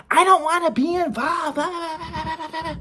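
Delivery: high, dismissive mom, fading into laughter; mocking mom voice. Son imitates mom refusing involvement, high laughing voice. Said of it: "Mom's like".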